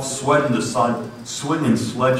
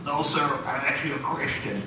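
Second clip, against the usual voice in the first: quiet, higher and scared; timid reply; 5 semitones higher, 8 dB quieter than usual. Retold or imitated. Frightened preacher answering the worker, timid and soft. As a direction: answer meekly with a small voice. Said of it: "my voice is cracking up".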